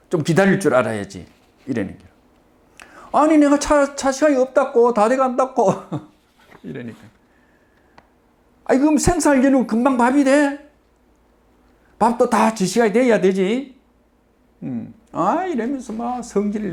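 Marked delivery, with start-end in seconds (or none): 3.1-5.7 impatient protest. Retold or imitated. Customer pleads about the bus. 8.7-13.8 grumpy scolding. Retold or imitated. Old man scolds the hurried customer.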